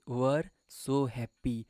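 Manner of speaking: slow reading. Shown as a demonstration. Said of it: "अब ये were क्या है भाई?".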